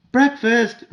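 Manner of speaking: loud, high cheerful call; mother calling the family to breakfast; called cheerfully. A narrator's reading. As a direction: ring it out from the kitchen. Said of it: "called cheerfully".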